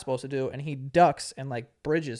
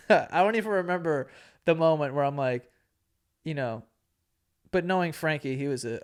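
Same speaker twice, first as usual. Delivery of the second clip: ordinary talk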